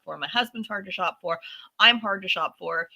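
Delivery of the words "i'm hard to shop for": ordinary delivery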